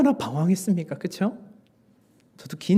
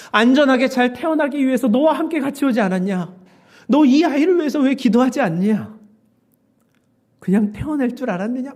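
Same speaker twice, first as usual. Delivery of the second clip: raised, loud rebuking questions; reproachful pressing; 9 semitones higher, 7 dB louder than usual. Retold or imitated. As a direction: press him hard, as the Lord chiding. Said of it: "다그치시는 거예요". (높고 큰 소리로 다그치듯)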